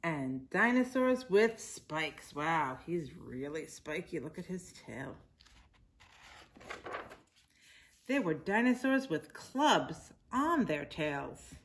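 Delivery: patient picture-book narration; reading to children. A narrator's reading. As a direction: read it aloud to little kids, drawing out the words.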